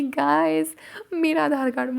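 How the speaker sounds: high, whining plea; whiny wail. Retold or imitated. Voice note to friends about lost ID, whiny wail. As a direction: moan it like a lost cause. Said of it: "ऐसे voice में ... voice messages".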